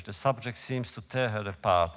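plain reading voice

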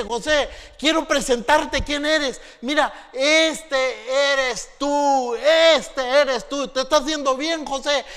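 slow, loud, high jeering; mock him, stretch every word; taunting brothers. Brothers stripping Joseph, slow loud taunt.

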